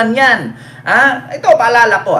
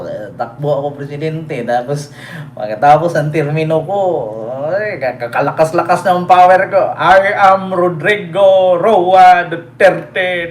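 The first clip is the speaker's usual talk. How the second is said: mock quote; 6 semitones lower than usual. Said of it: "Sabi ni Isko".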